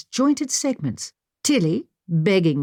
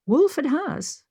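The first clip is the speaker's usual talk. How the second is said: puzzled question; 10 semitones higher, slower than usual. A narrator's reading. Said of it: "I questioned".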